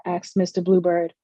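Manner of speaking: ordinary delivery, nothing marked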